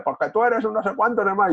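a hammed-up shouted accusation; mock yell. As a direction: play the one who's shouting at you. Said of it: "si el otro grita y dice".

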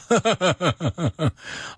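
big high laugh; hearty high laugh. Real, not acted. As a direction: burst out laughing. Laughs after curse.

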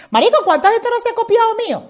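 high, loud exasperated cry; indignant outburst. Real, not acted. To copycats in her shows, loud and outraged. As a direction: throw the question up in outrage.

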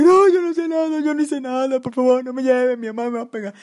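high, hammed-up wailing plea; imitated scream. Imitating the arrested friend pleading with police, screamed mock wail. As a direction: start with a loud wail and keep begging high, easing slightly. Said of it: "no me escuchamos el grito de que".

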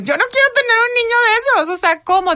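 high, loud outburst of disbelief; incredulous protest. Retold or imitated. Mother retelling her shock at the news, loud incredulous protest. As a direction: blurt it out in high-pitched protest.

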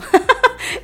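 risa burlona. Real, not acted. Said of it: "risa tras presumir".

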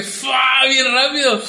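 high whooshing exclamation; hammed-up excited sound effect; imagined lightning-fast punch. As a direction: mimic a superhero punch with gusto. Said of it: "en mi mente era así como que".

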